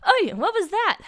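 very high, loud alarm; acted jump of fright; startled. A narrator's reading. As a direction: yelp the question, high and loud. Character startled by noise in bushes.

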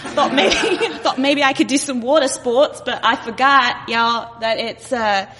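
slightly shaky imitation Australian accent. Visitor on vacation greeting the locals, imitated Australian accent. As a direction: have a go at an Aussie accent. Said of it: "what if I were Australian?".

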